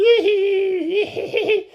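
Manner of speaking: shrill cackling laugh imitation; high mock laughter. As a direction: cackle like the audience did.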